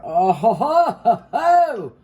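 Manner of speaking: a giddy chef's rising chuckle; gleeful laugh. Real, not acted. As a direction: cackle with delight as the sauce goes on. Delighted while pouring sauce.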